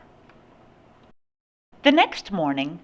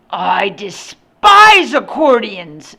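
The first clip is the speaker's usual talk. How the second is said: loud, slow, drawn-out loathing; grumpy disgust; 3 semitones higher, 10 dB louder, slower than usual. A narrator's reading. Grumpy man to accordion players, loud disgust.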